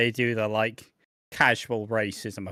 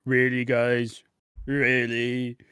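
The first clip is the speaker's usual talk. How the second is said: imitating The Miz; slower than usual. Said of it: "The Miz twenty ten, like".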